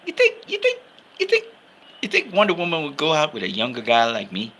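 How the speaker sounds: a hint of an eager young hero asking; mock hopeful Flash question; Flash's eager voice. Flash asking Cyborg about Wonder Woman.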